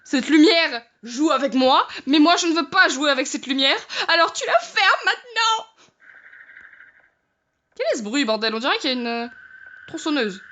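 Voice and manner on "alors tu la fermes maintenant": high, tearful scolding; crying plea. Real, not acted. Scared by the flickering light, tearfully ordering it. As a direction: snap at the light close to tears.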